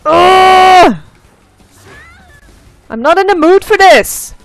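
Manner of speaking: loud high raging shouts; real rage; furious yelling. Real, not acted. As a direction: scream curses at the enemy. Raging at cheating enemy.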